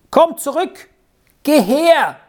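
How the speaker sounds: friendly call. A narrator's reading. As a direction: call the boy back kindly from afar. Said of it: "rief sie freundlich aus dem Fenster".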